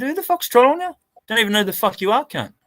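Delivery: high, emphatic challenge; retold indignant comeback. Answering a troll in the comments, high and indignant. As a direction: start very high and affronted, then come down. Retelling his challenge in chat.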